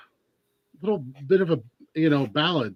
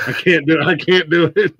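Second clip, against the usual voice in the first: laughing, louder, can't get the words out; 3 semitones higher, 6 dB louder than usual. Real, not acted. Host laughing at the thought of Sharon Osbourne's accent, laughing. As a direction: breaks into laughter mid-line.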